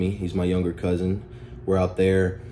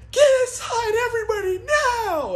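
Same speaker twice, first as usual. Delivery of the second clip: very high shrieking laughing mock grandma; grandma shouting. Grandma ordering the kids indoors, shrieked command. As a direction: screech the order like an angry grandma.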